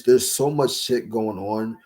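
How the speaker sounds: normal talking voice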